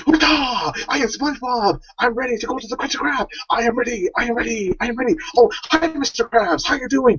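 high, excitable cartoon voice; anime SpongeBob. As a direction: squeal it like an eager anime hero. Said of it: "He'll be like".

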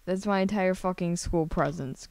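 usual speaking voice

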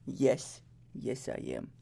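cat's reply. Pretend. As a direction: answer for him in a deep voice. Answering for the cat.

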